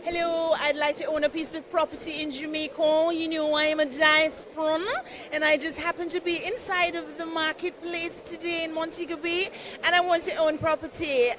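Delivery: high put-on voice of a visitor from abroad; mock diaspora visitor. Host plays a diaspora visitor pitching to the banker, high put-on voice. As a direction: play an eager returning Jamaican asking to buy. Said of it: "I just show up with an idea".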